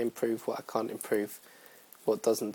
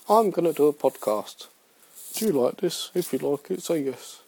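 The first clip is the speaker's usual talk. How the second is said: higher-pitched self-quote, pitching an idea; mock-eager, raised voice; acted eager announcement; 4 semitones higher than usual. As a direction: act out eagerly asking friends. Acts out announcing the podcast idea.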